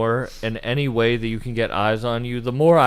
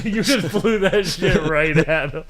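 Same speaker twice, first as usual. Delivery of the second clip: laughing; 4 semitones higher than usual. Real, not acted. Teasing friend, laughing.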